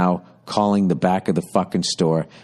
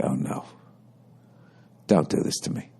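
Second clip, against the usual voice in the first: low, soft mock pleading; hushed retold dread; 8 semitones lower, 7 dB quieter than usual. Customer silently begging the store clerk, hushed dread. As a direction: plead quietly under your breath. Clerk starts making a face.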